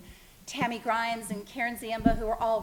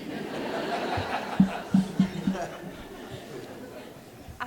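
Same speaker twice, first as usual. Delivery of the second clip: drawn-out rueful laugh; long wry laugh; 4 semitones lower than usual. Real, not acted. Laughing after the accusation.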